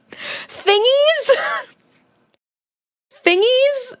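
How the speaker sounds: high, baffled question; amused puzzled echo; incredulous. Real, not acted. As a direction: repeat the silly word in disbelief. Incredulous repeat of odd word.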